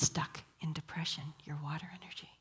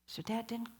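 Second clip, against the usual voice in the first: normal talking voice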